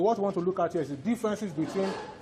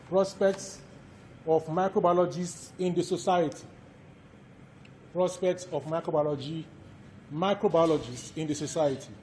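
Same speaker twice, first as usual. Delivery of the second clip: dictation. Acted. Lecturer dictates course outline.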